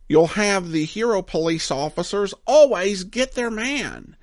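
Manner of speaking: ordinary talk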